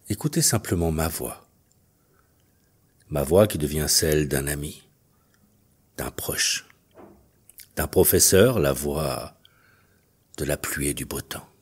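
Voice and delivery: low, measured hypnotist's voice with long pauses; hypnosis induction. Acted. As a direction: guide them gently into trance, leaving space between phrases. Leads a hypnosis session.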